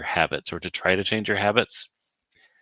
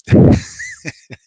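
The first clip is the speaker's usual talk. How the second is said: high squealing laugh; 13 dB louder than usual. Real, not acted. Laughing at own mud mishap.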